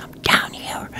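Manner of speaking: imitated whisper, very soft; whispered reply. Hidden man to searcher above him, whispered reply. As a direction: answer in a voiceless whisper.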